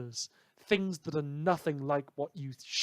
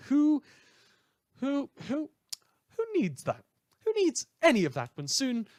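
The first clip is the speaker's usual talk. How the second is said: much higher, louder mocking cry; scornful who; 11 semitones higher, 7 dB louder than usual. Radio host mocking listeners' rumours, high scornful cry. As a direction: sneer at the pointless guessing.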